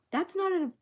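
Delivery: normal talking voice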